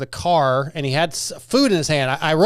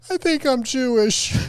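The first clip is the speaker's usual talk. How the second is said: a hint of a nervous boy, higher and slow; son's confession; 8 semitones higher than usual. Teenage son confessing to his father, lightly higher and hesitant. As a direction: quote the kid's big reveal, slowed.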